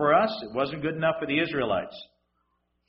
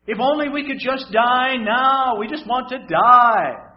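higher, louder mock wail of despair; whining crowd; 7 semitones higher, 5 dB louder than usual. Preacher voicing the Israelites screaming at Moses, higher mock whining. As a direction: whine like people who want to die. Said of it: "thousands of people screaming at him".

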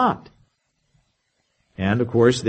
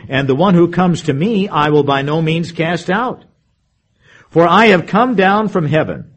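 scripture reading; 3 semitones higher, 4 dB louder than usual. Acted. Preacher reads scripture passage.

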